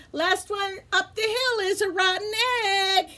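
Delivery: high, called-out challenge; calling out. Acted. Gilbert chasing Wally, calling out high. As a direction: call it up the hill to Wally.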